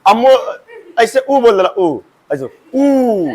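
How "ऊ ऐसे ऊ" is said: ऊ slowly stretched with lips rounded, half laughing; rounded long oo. Shown as a demonstration. Teacher showing pupils how the mouth rounds for ऊ, laughing. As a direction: round the lips like an owl and hold ऊ. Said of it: "उल्लू नियन मुंह आ गल".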